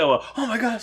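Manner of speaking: high, excited squeal; hammed-up fan excitement; mock fan gush. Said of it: "it wasn't like".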